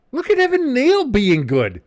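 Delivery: loud, very high whoop of amazement; thrilled outburst at a player doing well; excited cheer. Real, not acted. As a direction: shout the praise high and loud. Admiring player, louder repeat.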